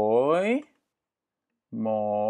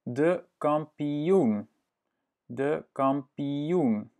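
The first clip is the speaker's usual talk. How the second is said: de kampioen said slowly, "kampiun"; teacher models de kampioen for the learner; slow Dutch word; 3 semitones higher, much slower than usual. Shown as a demonstration. Slow, careful model. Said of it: "teacher repeats word slowly".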